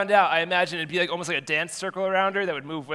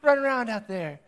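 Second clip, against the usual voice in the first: cooing spectator; 8 semitones higher than usual. Retold or imitated. Spectators cooing at the coach's kids, high mock gush. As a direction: coo over the kids like a doting fan. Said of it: "And they go".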